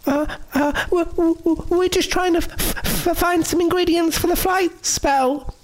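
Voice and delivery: put-on high, stammering scared girl; shaking with fear. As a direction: stutter it out, frightened. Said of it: "her voice shaking with fear".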